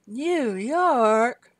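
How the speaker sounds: put-on drawn-out "New York" with dropped R, rising, like the old ad; salsa commercial New York. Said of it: "what's that salsa commercial?".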